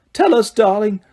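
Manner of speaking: ordinary delivery, nothing marked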